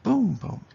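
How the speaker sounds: first "boom" high and loud, second dropped; trochee beat. Shown as a demonstration. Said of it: "That's a trochee".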